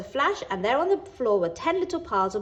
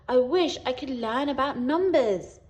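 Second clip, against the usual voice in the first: sighed; 4 semitones higher, 3 dB louder than usual. A narrator's reading. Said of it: "she sighed".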